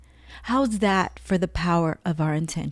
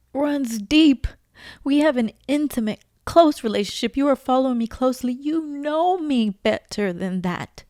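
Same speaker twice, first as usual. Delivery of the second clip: God's voice; 6 semitones higher than usual. Retold or imitated. God speaking to her, high.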